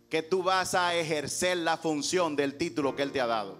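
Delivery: normal talking voice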